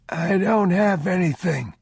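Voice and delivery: a beggar cowering, afraid of being robbed; terrified old stranger begs travelers, high and loud; frightened old man. Acted.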